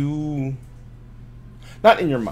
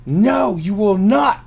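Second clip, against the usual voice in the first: forceful yell, building higher and louder; 8 semitones higher, 4 dB louder, much slower than usual. Acted. Voice yelling inside the player's head, building yell. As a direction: yell each command, with gaps between bursts. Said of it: "the voice is yelling".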